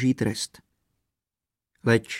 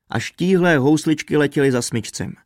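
ordinary delivery, nothing marked

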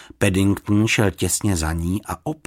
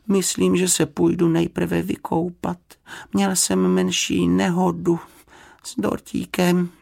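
high, even, measured character voice; high, slow, courteous bear; polite little bear; 10 semitones higher than usual. A narrator's reading. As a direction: explain politely as a small bear.

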